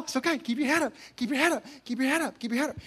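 soft, gentle coaxing; calm them down softly; reassuring. Retold or imitated. Coach soothing a crying player, softly. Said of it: "constantly be saying".

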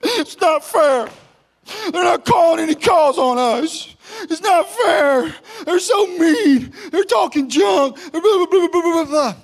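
put-on high, loud crying whine of a sulking kid; whining kids. Players complaining about the refs, high tearful whine. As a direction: wail it like an outraged child. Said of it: "And half my team".

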